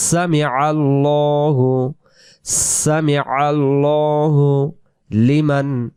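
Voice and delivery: সামি আল্লাহু recited slowly with a full, rounded "lo"; measured recitation, long rounded vowel in আল্লাহু; rounded "lo" demo. Shown as a demonstration. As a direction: draw out আল্লাহু with a heavy rounded L. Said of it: "এই জন্য এই জায়গায় আমরা পড়বো".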